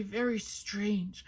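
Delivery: ordinary delivery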